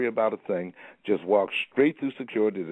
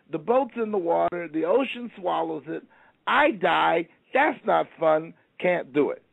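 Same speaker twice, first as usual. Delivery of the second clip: high anxious voice, much higher, slowing on the fear; a frightened man explaining why he won't go; scared high whine; 11 semitones higher than usual. Retold or imitated. Man refuses the boat, voiced.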